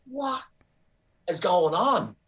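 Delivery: high-pitched incredulous "what"; exasperated disbelief. Acted. Comedian reacting to a rant about his friend, exasperated disbelief. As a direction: leap up high on the first word, then pause.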